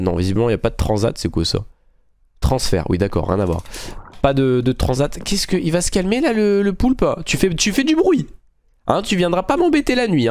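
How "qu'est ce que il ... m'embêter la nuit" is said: high-pitched playful telling-off; mock scolding. Player telling off the noisy octopus, high mock scolding. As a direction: scold it in a high, put-on fussy voice.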